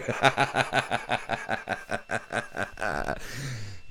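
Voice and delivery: laughing at his own slip; a quiet chuckle at the flub; amused laughter at himself; real laugh. Real, not acted.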